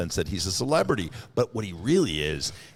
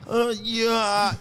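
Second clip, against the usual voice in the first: very high hammed-up character voice; Celie from Color Purple; 13 semitones higher, 4 dB louder than usual. Slow reader mocking their own reading, high character voice. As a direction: strain it up high in a crude impression. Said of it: "I'm like Seeley in Color Purple".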